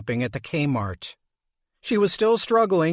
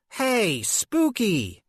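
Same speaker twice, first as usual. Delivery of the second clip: teasing nickname; 7 semitones higher, much slower than usual. Acted.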